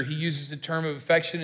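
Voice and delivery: normal talking voice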